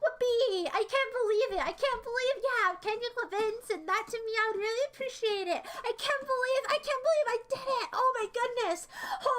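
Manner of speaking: squealing victory celebration; overjoyed cheering. Real, not acted. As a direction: chant it high and loud, over and over in triumph. Celebrating the successful impression.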